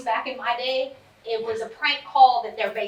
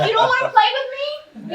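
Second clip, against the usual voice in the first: high, loud mock pleading with a laugh; mock hurt mom; 13 semitones higher, 6 dB louder than usual. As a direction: pretend to be wounded he won't play. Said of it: "I'm like".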